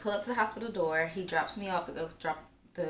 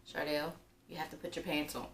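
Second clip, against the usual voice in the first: self-talk; 3 semitones lower, 6 dB quieter than usual. Retold or imitated. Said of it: "trying to be like".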